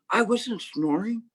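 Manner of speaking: high, slow, insistent denial; indignant master. Acted. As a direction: dig in like a cornered old teacher.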